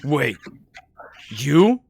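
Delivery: bewildered. Acted. Revived head finds itself facing its killers, bewildered.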